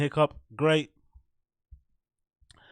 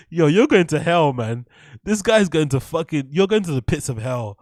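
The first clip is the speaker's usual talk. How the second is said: normal talking voice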